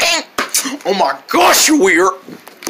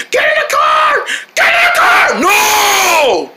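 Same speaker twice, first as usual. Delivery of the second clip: put-on shouting at the runaway boy to get in; yelling order. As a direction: yell at the kid to get in the car now. Parent yelling child into car.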